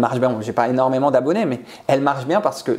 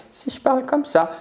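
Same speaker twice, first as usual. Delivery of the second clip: much higher, odd voice for a demo; squeaky demo of a different tone; high put-on voice; 11 semitones higher than usual. Shown as a demonstration. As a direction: pitch it way up to show tone. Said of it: "c'est le ton avec lequel je vais parler".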